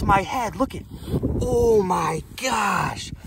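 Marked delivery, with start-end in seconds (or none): 1.4-3.1 awed disbelief. Real, not acted. Amazed at perch.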